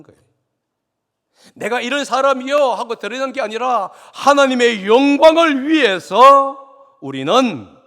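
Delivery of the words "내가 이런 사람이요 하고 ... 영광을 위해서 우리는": loud, high-pitched pulpit declamation; forceful preaching. Real, not acted. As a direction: drive the point home to the congregation at full voice.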